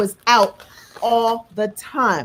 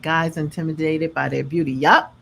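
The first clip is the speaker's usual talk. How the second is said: ordinary delivery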